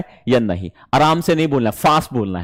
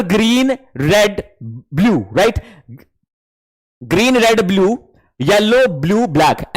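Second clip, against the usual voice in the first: demo naming colors; 2 semitones higher, slower than usual. Shown as a demonstration. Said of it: "कैसे बोलना है बच्चे".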